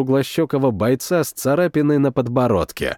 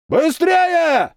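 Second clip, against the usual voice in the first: drill sergeant; 14 semitones higher than usual. A narrator's reading. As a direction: bark at the squad to hurry. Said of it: "заорал старшина".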